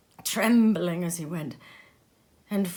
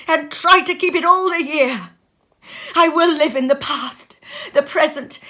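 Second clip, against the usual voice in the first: loud, higher, tearful vows; agonised entreaty; 6 semitones higher, 9 dB louder than usual. Acted. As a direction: swear to change, begging in anguish. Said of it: "In his agony".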